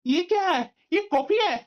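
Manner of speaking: high-pitched, outraged complaint; indignant husband's outburst; angry yelling. Acted. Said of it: "इस तरह से तुम चिल्ला क्यों रहे हो?".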